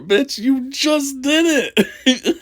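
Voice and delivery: high, slow laughing jab; laughing disbelief. Real, not acted. Mocking the man's memory while laughing.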